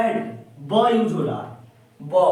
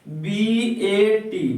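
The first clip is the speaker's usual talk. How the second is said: slow spelling; much slower than usual. Shown as a demonstration. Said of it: "teacher spells word slowly".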